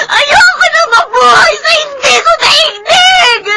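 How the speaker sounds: mataas at malakas na hinaing na may iyak; umiiyak na pagsabog. Acted. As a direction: ibuhos ang sama ng loob habang umiiyak. Daughter's tearful outburst at father. (umiiyak na pagsabog ng damdamin sa ama)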